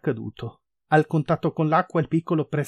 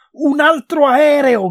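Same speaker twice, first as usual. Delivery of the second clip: high, loud, stretched-out cry of alarm; alarmed shout; 11 semitones higher, 10 dB louder, slower than usual. A narrator's reading. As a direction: shout a terrified warning. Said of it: "gridò Cheryl".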